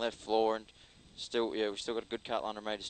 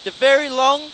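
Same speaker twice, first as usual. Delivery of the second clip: loud, urgent co-driver calls; rally pace notes; 12 semitones higher, 13 dB louder than usual. Real, not acted. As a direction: bark out corner calls over the engine.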